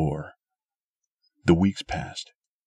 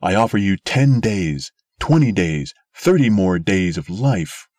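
loud offer; 3 semitones higher, 6 dB louder than usual. A narrator's reading. Sim offering to the crowd.

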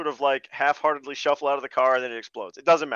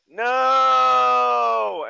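higher, louder hammed-up scream of grief; mock shout; 7 semitones higher, 5 dB louder than usual. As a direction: yell it like a bad action-movie hero. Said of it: "He's like".

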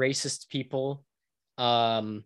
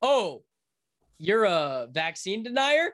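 mock incredulous; 6 semitones higher than usual. Mock questioning before the snip.

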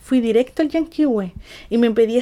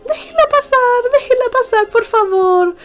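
much higher, crying plea; tearful pleading; 11 semitones higher than usual. Retold or imitated. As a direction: beg the crowd through tears. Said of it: "lo único que escuchaba decir era".

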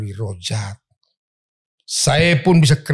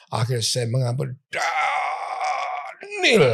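crying out; 3 semitones higher, 4 dB quieter, much slower than usual. Retold or imitated. Woman finally forgives, crying out.

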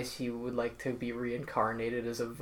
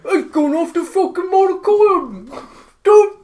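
high, loud, slow mock posh British; hammed-up posh British accent; 16 dB louder, slower than usual. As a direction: bellow like a caricatured aristocrat. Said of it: "I can't do accents".

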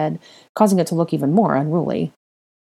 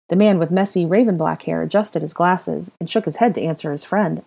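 ordinary delivery

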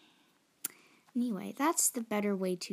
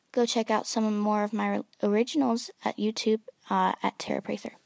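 louder ad-read pitch; commercial announcer voice; 8 dB louder, faster than usual. Pretend. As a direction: promote it like a radio host. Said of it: "sound like a commercial person".

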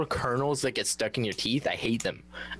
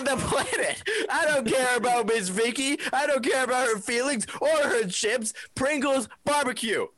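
shouting; 12 semitones higher than usual. Real, not acted. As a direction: yell it in outrage. Heated chip argument, shouting.

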